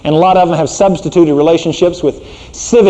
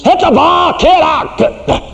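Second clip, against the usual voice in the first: high shouting dad at the fence; mock yell from the sidelines; sports dad yell; 14 semitones higher, slower than usual. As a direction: yell it like a pushy Little League father. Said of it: "these fathers down on the fence".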